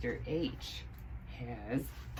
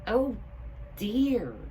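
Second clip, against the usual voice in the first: dismayed hat; 8 semitones higher, 5 dB louder than usual. A narrator's reading. Hildy dismayed.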